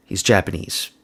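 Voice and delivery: ordinary delivery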